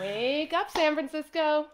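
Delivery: loud, high TV host opener; morning show host. Pretend. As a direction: greet the city like a breakfast host.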